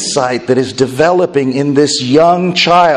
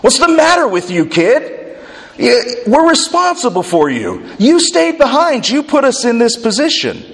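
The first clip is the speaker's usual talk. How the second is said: exasperated parent; 7 semitones higher than usual. Retold or imitated. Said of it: "I would respond with".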